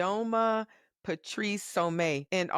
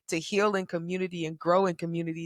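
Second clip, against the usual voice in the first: ordinary delivery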